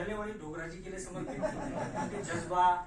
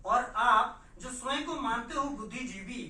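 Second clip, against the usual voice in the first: loud, raised, challenging declamation; forceful poem recital; 8 semitones higher, 8 dB louder than usual. Acted. Poet confronting silent intellectuals in verse, loud high declamation. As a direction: hurl each question at the listeners.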